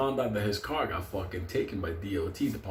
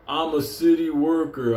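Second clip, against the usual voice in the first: loud, slow, higher-pitched declaration; self-important worker; 5 semitones higher, 5 dB louder than usual. Retold or imitated. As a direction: pull rank, stretching the words. Pound worker refuses, pulling rank.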